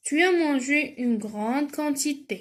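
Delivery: very slow, evenly spaced words; drawn-out teacher's reading; slow dictation reading. Shown as a demonstration. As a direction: read it out carefully for a class. Reads exercise sentence for spelling.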